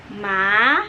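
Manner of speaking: ma rising upward; second tone, rising. Shown as a demonstration. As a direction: slide ma up. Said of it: "nada kedua itu dibaca sebagai". (nada kedua, ma naik)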